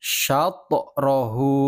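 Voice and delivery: "Syatorahu" slow, the t bouncing into a light o, "sya-to-rahu". Shown as a demonstration. Teacher models correct qalqalah for pupils, slow and bouncing. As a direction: let the t in "Syatorahu" rebound. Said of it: "Bukan syatrohu".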